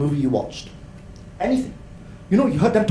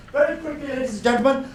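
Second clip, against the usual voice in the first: usual speaking voice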